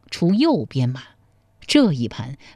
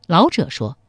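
plain reading voice

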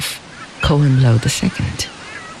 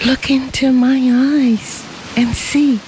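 put-on young woman Xie; 8 semitones higher than usual. A narrator's reading. Xie answers her husband, higher young woman's voice. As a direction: answer him lovingly, higher.

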